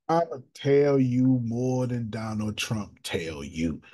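ordinary talk, nothing marked

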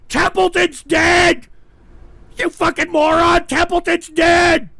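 high, loud, drawn-out outraged yell; angry shouting. Real, not acted. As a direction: yell it at a clueless heckler, stretching the words. Host rages at chat commenter.

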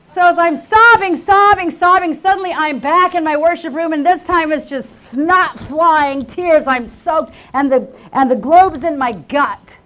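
high, loud cry from the pulpit; anguished, shouted sermon; impassioned preaching. Real, not acted. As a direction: preach it full force, stretching key words. Preaching at full force.

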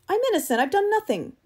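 very high, loud desperate denial; panicked protest. Acted. Eccles threatened with the gun, panicked protest. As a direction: cry out innocence in panic.

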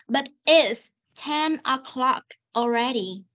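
"But it's ten o'clock already" very slow, with s and k audible; slow reading. Shown as a demonstration. Teacher corrects student's missing sounds, slow reading. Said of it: "Nghe cô đọc chậm này".

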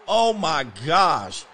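loud, slow drawn-out exclamation of disbelief; stunned oh my gosh. Real, not acted. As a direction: let it out long and loud, can't believe it. Opponent scores over his defender.

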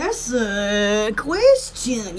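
very slow, dry reply; slow deadpan. Retold or imitated. Imitating someone's dry reply to her question, slowly. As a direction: drag it out dryly. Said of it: "You'll be like".

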